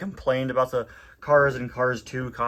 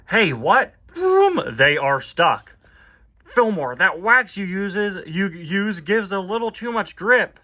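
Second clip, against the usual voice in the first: lively raised cartoon car voice; car character voice; 8 semitones higher, 7 dB louder, slower than usual. Reading a comic aloud, upbeat cartoon car. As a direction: voice the comic's race car brightly. Reads comic voicing cars.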